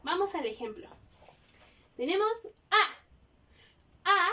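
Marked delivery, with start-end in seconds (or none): 2.0-4.1 "A" drawn out slow, mouth wide open; demonstrating A. Shown as a demonstration. Teacher showing pupils the open "A" sound. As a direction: open wide and hold the "A" long. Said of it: "sí va a sonar así".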